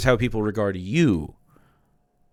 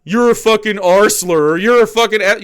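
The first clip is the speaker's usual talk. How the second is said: louder sneering insult, lightly voiced; mocking a right winger; 7 semitones higher, 8 dB louder than usual. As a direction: spit it like a mock troll taunt. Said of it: "How often do you go".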